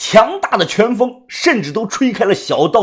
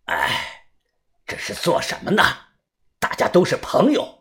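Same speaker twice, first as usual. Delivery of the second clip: soft sighing elder's voice; weary, hushed old man; old peacemaker; 6 dB quieter than usual. A narrator's reading. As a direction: soothe them quietly like an old man. Old man calming a fight.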